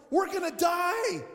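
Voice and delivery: high, loud, slow cry of doom; mock panic. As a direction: yell it like the car will crash. Panic in car, retold.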